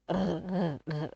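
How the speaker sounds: "R" as a repeated throaty French consonant sound; isolated R sound. Shown as a demonstration. Teacher isolating the sound for a child, "R, r, r" repeated. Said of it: "ça contient le son R".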